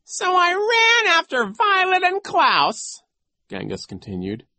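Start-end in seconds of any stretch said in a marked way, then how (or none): 0.0-3.0 high, slow, loud villain voice; oily mock bafflement; sly villain coach. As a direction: feign confusion, drawn out and high. Voices Genghis.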